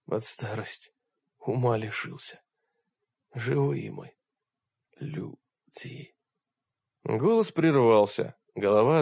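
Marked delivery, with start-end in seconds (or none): 0.1-6.1 much softer, dying away into a whisper; fading voice. Acted. Wounded warrior whispering to the wizard as his voice fades. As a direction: let the voice die out word by word. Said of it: "Мрак сказал затихающим голосом".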